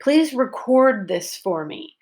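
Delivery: "record" as a verb, long O in the stressed second syllable. Shown as a demonstration. Teacher models the verb in a request, stress on the end of "record". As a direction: lean on the "cord" of "record". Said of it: "stress is on syllable number two".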